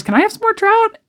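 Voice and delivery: mock sweet little girl. Girls mocking Vicky over trout.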